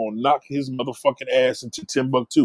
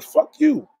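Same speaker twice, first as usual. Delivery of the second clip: mock dismissive retort; 14 semitones higher than usual. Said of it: "They're like".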